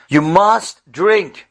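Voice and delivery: slow, firm, every word pressed; slow stern command to drink water; insistent order. Retold or imitated. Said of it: "but I insist".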